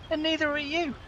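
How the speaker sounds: high, sharp mock retort; retold cheeky comeback. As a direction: land the put-down.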